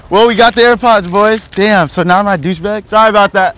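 high, loud mock-cocky boast; loud showboating. New AirPods owner playing rich for the camera, loud showboating. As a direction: call it out for everyone around to hear.

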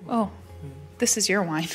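normal talking voice

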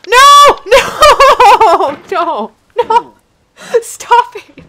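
high, loud "No!" shouts mixed with laughter; helpless hysterical yelling; laughing screams. Real, not acted. Gamer laughs and screams as he keeps falling.

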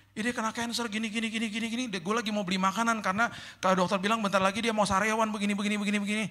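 a high, lightly voiced wife running through the story; wife explaining. Wife telling her friend why she is out, high quick explaining.

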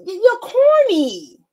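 high, stretched-out taunt; mocking put-down. Roasting the Caribbean guy, drawn-out mocking jeer.

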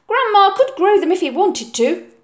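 high, loud, stubborn insistence; firmly. Acted. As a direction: refuse to be beaten. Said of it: "Oliver said firmly".

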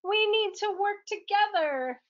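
rally the team in a bright high voice; heroic princess. Acted. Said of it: "she said".

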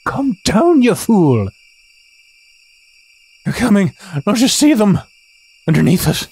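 Atri's odd voice. Acted. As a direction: shout the warning like a panicked seer. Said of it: "what the hell was Atri's voice".

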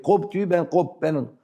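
ordinary talk

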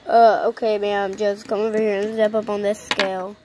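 low, bored doctor brushing off his patient; gruff doctor voice. Acted. Doctor telling a pregnant patient to step on the scale, low and bored. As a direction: drop low and play the unbothered vet.

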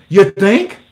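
mock the obvious; sarcastic. Real, not acted. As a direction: sarcasm at the news headline. Sarcastic reply to headline.

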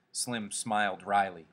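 usual speaking voice

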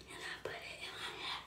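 quick, soft unvoiced whisper. Real, not acted. Whispering to herself while doing her hair. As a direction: breathe it out fast under your breath.

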